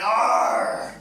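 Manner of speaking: loud breathy pirate Arr; pirate growl. Retold or imitated. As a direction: bark it like a pirate. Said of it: "He came up to me and went".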